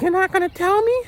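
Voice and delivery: high mock falsetto; whiny squeaky impression of her; imitating his date. Date pressing him about the seasoning, in a high mock falsetto. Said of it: "she's like".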